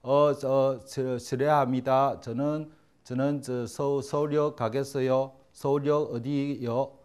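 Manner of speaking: hesitant, slow broken Korean of a foreign tourist; lightly mimicked foreign learner asking the way; foreigner's halting Korean. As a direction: stumble through like a lost foreigner. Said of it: "외국인이 와갖고 이런 코 이런 사람들이".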